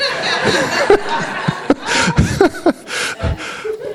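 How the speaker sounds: rising breathy laugh that climbs up; high airy laugh. Real, not acted. Laughing after colorful story.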